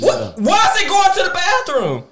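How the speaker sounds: angry mom. Retold or imitated. Mom seeing her Vaseline all over the house, outraged. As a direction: hit it like a furious mother spotting her stuff. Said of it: "but she was more angry".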